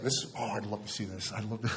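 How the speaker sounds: low, hushed inner voice; half-swallowed, softly voiced confession of a thought; muttered angry thought. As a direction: drop low, as if thinking aloud.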